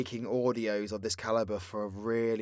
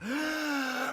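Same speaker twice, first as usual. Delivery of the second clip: big audible breath in; quick theatrical inhale; sharp gasp; 4 dB quieter than usual. Acted. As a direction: gulp air before racing on. Big breath before next recap part.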